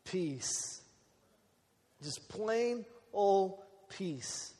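slow, softened, with long pauses; calm, peaceful. Real, not acted. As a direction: let the word settle gently after the chaos. Sermon point contrasting peace with chaos.

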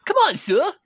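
high, loud Cockney cheer; egging him on in a Cockney voice; Cockney accent. Acted. The harbinger urges the dealer in Cockney. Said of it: "now he's gotten Cockney".

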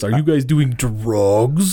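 slow, incredulous quote with "drugs" drawn out; mock-earnest question, slowly drawled; dead serious disbelief. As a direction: imitate someone earnestly asking, stretching out the last word. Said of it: "he was dead fucking serious".

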